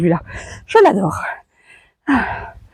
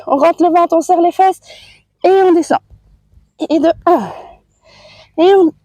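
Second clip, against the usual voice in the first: strained, higher voice mid-exercise; doing push ups; 4 semitones higher than usual. Real, not acted. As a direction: talk while pushing up.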